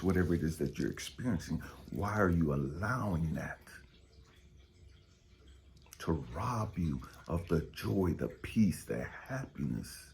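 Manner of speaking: ordinary delivery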